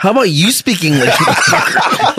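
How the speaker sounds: high-pitched mock outrage, cracking up; mock confrontation. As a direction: throw back an indignant retort, half laughing. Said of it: "And I wanna go".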